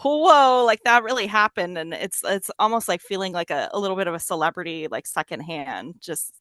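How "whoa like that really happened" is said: loud, high whoa of disbelief; thrilled disbelief, louder; amazed. Retold or imitated. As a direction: relive the stunned moment out loud. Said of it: "it was just kinda like".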